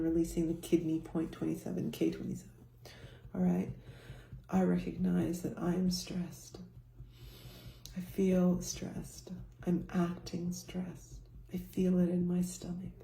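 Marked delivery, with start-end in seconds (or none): 4.5-12.9 whispered affirmation. Shown as a demonstration. Teacher whispers stress statements during tapping. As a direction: whisper your stress to yourself. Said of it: "tapping affirmation demo".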